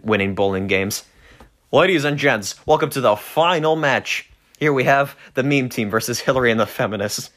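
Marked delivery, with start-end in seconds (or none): 1.7-7.3 sports announcer. Acted. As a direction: announce it like a bowling commentator. Bowling match announcer.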